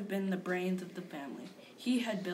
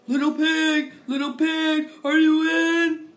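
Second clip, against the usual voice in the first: loud, higher call through the door; 6 semitones higher, 13 dB louder, slower than usual. Retold or imitated. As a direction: call out to the pig in a loud sing-song. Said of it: "so I called".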